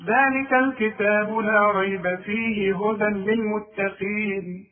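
slow chant linked from ذلك to للمتقين; no pause. Shown as a demonstration. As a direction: carry ريب and فيه straight on without stopping. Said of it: "ثلاث طرق لقراءة هذه الآية".

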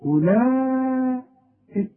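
أولئك with the long vowel stretched, very slow; drawn-out madd. Shown as a demonstration. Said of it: "مثلًا المد المتصل". (مدّ طويل في أولئك)

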